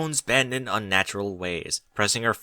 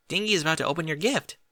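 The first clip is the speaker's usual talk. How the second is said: fast, high, eager announcement; can't wait to see the present opened; excited; 6 semitones higher, faster than usual. A narrator's reading. Derpy telling Bucky the gift is being opened, fast and excited. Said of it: "Derpy said excitedly".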